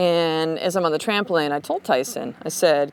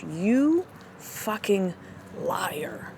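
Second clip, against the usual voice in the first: drawn-out, softening accusation; accusing inner voice; 6 dB quieter, much slower than usual. Acted. Inner voice calling out your own bullshit, slow quiet accusation. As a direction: stretch each word, then drop quiet. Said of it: "little fucking piece of you that goes".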